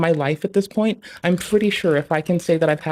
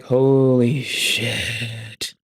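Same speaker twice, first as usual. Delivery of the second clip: drawn-out exasperation; 5 semitones lower, much slower than usual. Real, not acted. Overwhelmed by work grind.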